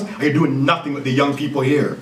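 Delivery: normal talking voice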